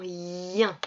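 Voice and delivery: "ien" slowly, long i into nasal un; drawn-out I. Shown as a demonstration. Teacher naming the sound heard in indien, i drawn out. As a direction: hold the i of "ien".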